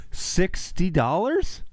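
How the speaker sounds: high, slow, drawn-out disbelief; stunned by the price, stretch it out; incredulous. Real, not acted. Reading a toll fee aloud to viewers, incredulous and slow.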